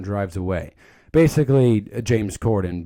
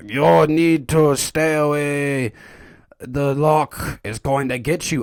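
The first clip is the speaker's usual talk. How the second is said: slow, raised warning voice; hammed-up mysterious doom-sayer; ominous stranger impression; 4 semitones higher, 4 dB louder, slower than usual. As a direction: draw out a spooky Scottish warning.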